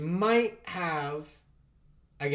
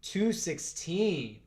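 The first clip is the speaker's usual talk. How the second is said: ordinary talk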